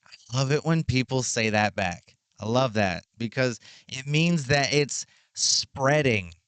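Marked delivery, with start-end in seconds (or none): none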